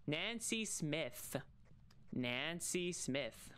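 Nancy Smith said very slowly, each syllable apart; slow it right down to get the tongue twister out; slow careful. Shown as a demonstration. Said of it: "That's a tongue twister".

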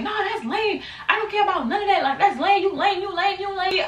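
high dismissive voice; imitating Lucky. Best friend scorning the club fight, high and dismissive. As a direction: brush it off like it's beneath you.

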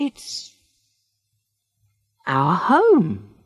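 a breathless "It's", then a slow "our home"; slowly. A narrator's reading. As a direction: draw it out hesitantly. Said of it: "she said slowly".

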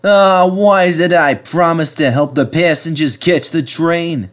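cheerful bus regretting his promise; fretful bus voice. A narrator's reading. Bertie the bus complaining.

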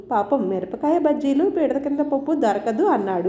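usual speaking voice

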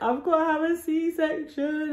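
high, drawn-out tearful wail; mock crying. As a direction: wail it slowly, imitating yourself in tears. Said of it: "So I'm upstairs crying, like".

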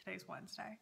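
ordinary talk, nothing marked

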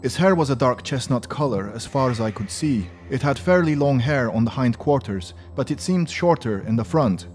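put-on English spoken with a heavy French accent. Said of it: "Sorry, that's my French accent".

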